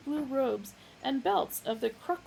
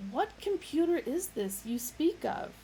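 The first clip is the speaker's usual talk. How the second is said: raised, inquiring voice; curious official voice; 7 semitones higher than usual. Acted. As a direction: ask it up high and curious. Lunkwell voice.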